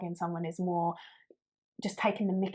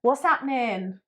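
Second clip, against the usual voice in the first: mock greeting; 5 semitones higher, 5 dB louder, slower than usual. Shown as a demonstration. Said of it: "that's what people say".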